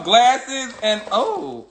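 ordinary talk, nothing marked

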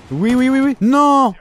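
gamer's thrilled burst; excited yelp. Real, not acted. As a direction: hype yourself up, then a sharp no. Reacting to the game.

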